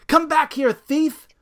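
high, loud angry yell; furious shout. Acted. Angry super shouting at the biker, furious yell. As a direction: scream after a bike thief. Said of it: "He's mad, waving his fist".

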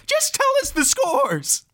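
high, drawn-out outburst; exasperated demand. Acted. Son demands the scores from stalling mother, exasperated. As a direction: cut in, out of all patience.

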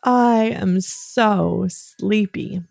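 very slow, stretched-out pretend drowsiness; dramatically sleepy. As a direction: act hugely sleepy, drawing out each word. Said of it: "I want you to be very dramatic".

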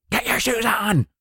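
high-pitched exasperated shout; yelling. Acted. Parent finally yelling at child to get shoes on. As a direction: snap into a strained high yell. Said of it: "why do you always yell?".

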